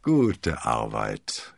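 low, very slow satisfied praise; drawn-out, smug approval; grinning. A narrator's reading. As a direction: savour it with a grin. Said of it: "grinste D'Artagnan".

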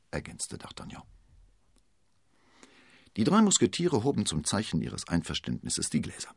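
plain reading voice

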